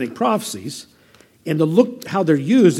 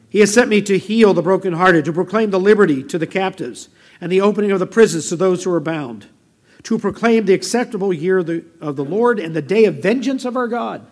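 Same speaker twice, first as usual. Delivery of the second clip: louder, declaimed Bible reading; scripture reading; 2 semitones higher, 5 dB louder than usual. Acted. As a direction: proclaim the verse to the congregation. Reads scripture aloud to congregation.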